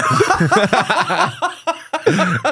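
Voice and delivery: squealing, helpless laughter; high wheezing laugh; high laughing fit. Real, not acted. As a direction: break up laughing. Laughing hard.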